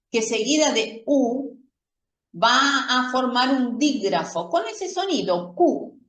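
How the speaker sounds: normal talking voice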